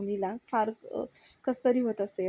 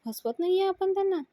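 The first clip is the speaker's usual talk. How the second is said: much higher, incredulous echo; retold exasperation in a high voice; indignant retort; 9 semitones higher than usual. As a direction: fling the claim back at him, pitch leaping. Avni sarcastically challenges Raja.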